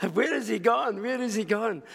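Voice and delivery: mildly frantic bewilderment, re-enacted; retold bewildered search. Retelling frantic search for vanished man.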